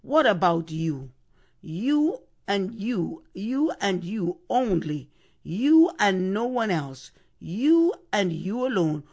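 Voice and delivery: each "you" punched hard and slowed; emphatic you. Shown as a demonstration. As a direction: lean on every "you", pointing at one listener. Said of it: "would have sounded more like this".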